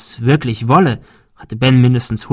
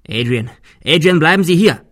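louder, rising call after him; 5 semitones higher, 4 dB louder than usual. Acted. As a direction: call him back before he runs. Calling after fleeing Adrian.

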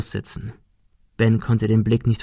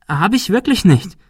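frightened denial; 9 semitones higher, 3 dB louder than usual. Acted. Girl denies again.

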